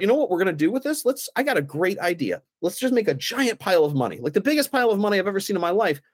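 high, fast sarcastic enthusiasm; mock excitement. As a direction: pitch the absurd idea like it's brilliant. Mock brainstorm of burning money.

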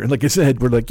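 normal talking voice

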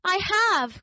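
little rabbit crying out in protest. Acted. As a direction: protest sharply, high. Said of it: "cried the little rabbit".

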